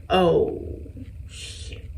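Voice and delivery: breathy whispered curse; whispered dismay. Retold or imitated. Patient hearing the diagnosis, whispered curse. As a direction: mutter it like the bad news just hit. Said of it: "So I'm like".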